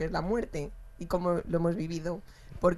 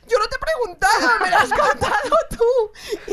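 mock exasperated boyfriend; 13 semitones higher, 8 dB louder, slower than usual. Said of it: "Y me dijo".

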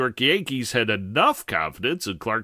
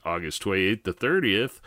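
ordinary talk

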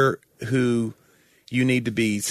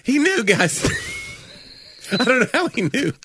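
high, wobbly fake-tearful voice; hammed-up tearful gush; mock choked-up; 13 semitones higher than usual. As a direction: ham up the emotion, voice cracking high.

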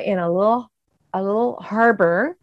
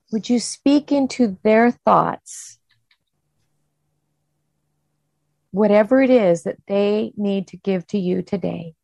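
prayer; 2 semitones higher than usual. Acted. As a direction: pray gently over the listeners. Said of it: "leading us in kind of that breathing".

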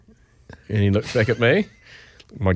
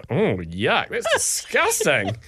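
put-on disgusted child; much slower than usual. Stepdaughter hearing mum's answer, disgusted. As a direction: imitate a grossed-out little girl. Six-year-old reacts to the answer.

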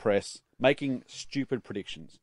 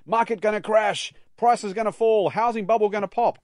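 alarmist headline voice; 7 semitones higher, 7 dB louder than usual. Retold or imitated. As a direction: announce the crash like a tabloid. Mock scary headlines.

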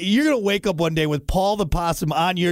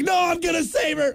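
mock heroic shout; 11 semitones higher than usual. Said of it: "You're like".

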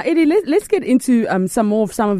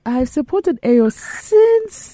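boasting parent; 5 semitones higher than usual. Retold or imitated. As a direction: stretch out a parent's proud claim. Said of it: "I'm sure their parents are now".

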